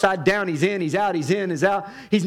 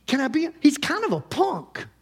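ordinary delivery

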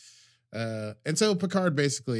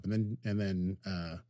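usual speaking voice

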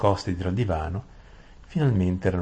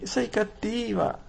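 tearful whimper; slower than usual. Acted. Saralin whimpers at Aravis in tears, very high. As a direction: whimper Sei cattiva like a hurt child. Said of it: "si lamentò ... fra le lacrime".

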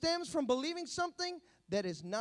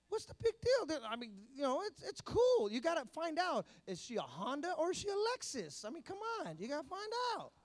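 casual persuader; 3 semitones higher than usual. Acted. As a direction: talk them into it, pitched up. Acting out today's permissive culture.

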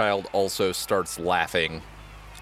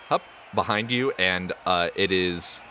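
normal talking voice

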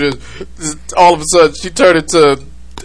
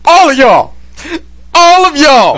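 high, loud mock dad shout; dad yelling; 10 semitones higher, 4 dB louder, slower than usual. Dad answering which boy he wants, yelling. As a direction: holler it at the kids, high and drawn. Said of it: "I was yelling at my boys".

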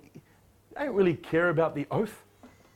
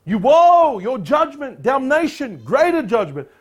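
louder and higher, thundering; mock condemnation; 6 semitones higher, 7 dB louder, slower than usual. Preacher voicing Jesus condemning Pharisees, thundering. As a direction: thunder the judgment. He's damning them to hell.

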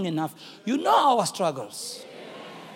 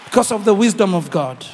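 starts strong, climbs higher and louder to brighter; building preaching; 3 semitones higher, 8 dB louder than usual. Acted. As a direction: build the crowd up, word by word.

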